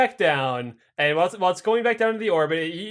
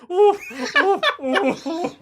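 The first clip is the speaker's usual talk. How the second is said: high laughing monkey ooh-ooh; monkey hoots; 11 semitones higher, slower than usual. Retold or imitated. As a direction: hoot like a crippled monkey. Imitating the monkey again.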